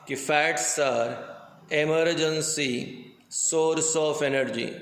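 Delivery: each word spaced out slowly, teacher stressing the key phrase; slow emphatic recap. Shown as a demonstration. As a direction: drill home "emergency source of energy" word by word. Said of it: "मैंने थोड़ी देर पहले ही कहा". (धीरे-धीरे, हर शब्द पर ज़ोर)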